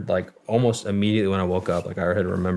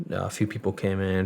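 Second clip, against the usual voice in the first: normal talking voice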